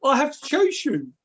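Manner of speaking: high, sheepish monster voice; monster's reply. Retold or imitated. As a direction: dream monster answers in a high meek voice. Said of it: "and he goes".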